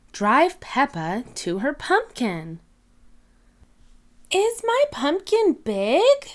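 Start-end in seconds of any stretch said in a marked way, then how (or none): 4.3-6.3 put-on very high, hopeful child's question; eager little piglet. Peppa asking her grandparents, in a very high eager voice. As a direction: squeak it up like an excited little girl. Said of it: "Peppa asks".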